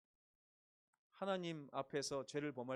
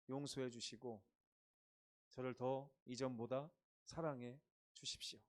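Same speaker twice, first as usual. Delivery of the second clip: lower, soft sincere prayer; humble prayer; 4 semitones lower, 3 dB quieter than usual. Acted. Believer praying to the Father, softly sincere. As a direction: pray quietly from the heart. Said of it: "이렇게 울었으면 좋겠습니다".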